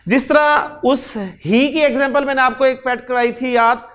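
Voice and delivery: ordinary talk, nothing marked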